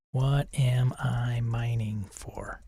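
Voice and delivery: slow unvoiced whisper. Shown as a demonstration. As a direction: breathe the question slowly. Repeats prompt as whisper.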